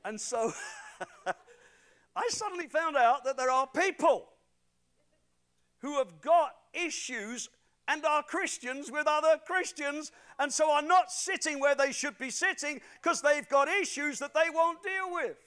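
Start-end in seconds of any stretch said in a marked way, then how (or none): 0.6-1.5 short airy laugh after the joke; breathless laugh. Real, not acted. 2.2-15.4 loud, high preaching voice; full-force preaching. Acted. Preaching climax.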